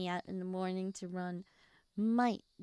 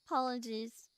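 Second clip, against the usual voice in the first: usual speaking voice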